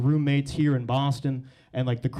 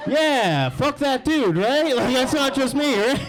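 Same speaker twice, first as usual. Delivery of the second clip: worked-up rant; 7 semitones higher, 3 dB louder, slower than usual. Real, not acted. Ranting to the crowd about his dentist, riled up. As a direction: pitch jumps high as he rants.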